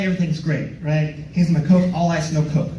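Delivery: ordinary talk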